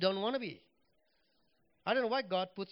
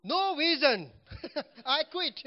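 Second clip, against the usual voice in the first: blunt announcement; 6 semitones higher, 6 dB louder, slower than usual. Retold or imitated. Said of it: "go to the pulpit and say".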